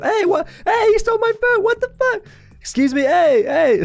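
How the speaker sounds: very high, squawking lady voice; hammed-up indignant falsetto; outraged lady. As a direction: call after a food thief in shrill falsetto. Robbed lady yells after thieves.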